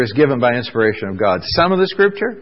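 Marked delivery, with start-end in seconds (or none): 1.4-2.4 rhetorical question. Acted. Rhetorical quiz to congregation.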